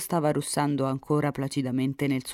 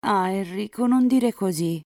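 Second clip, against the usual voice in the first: high, louder pleading protest; reproachful heroine; 6 semitones higher, 4 dB louder than usual. A narrator's reading. Elisa stopping Enrico insulting his father, high reproach. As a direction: cut in to reproach him, voice rising.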